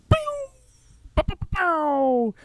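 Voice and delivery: high, slow cartoon gunshot sounds; mock pew pow noises. Narrator voicing the climax as laser blasts, high cartoon pew sounds. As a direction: fire off silly pew pow sound effects.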